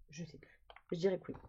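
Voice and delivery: whisper. Real, not acted. Muttering doubt to herself.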